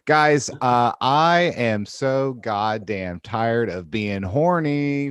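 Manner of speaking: loud, drawn-out comic exasperation; slow, emphatic fed-up outburst; exasperated complaint. Acted. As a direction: announce you are utterly fed up, slowly.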